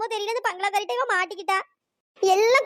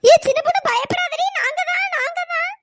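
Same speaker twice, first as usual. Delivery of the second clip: high, loud calling; raised voice, insistent and high; urgent reassuring shout; 7 semitones higher, 6 dB louder than usual. Acted. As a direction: call out to a panicking friend. Shouting to reassure panicking friend.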